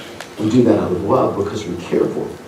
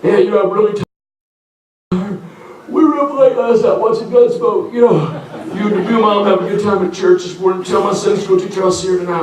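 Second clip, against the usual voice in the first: put-on sleepy teen excuses; 8 semitones higher than usual. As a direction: yawn it out like a lazy kid begging off church. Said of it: "I can just imagine one of those mornings".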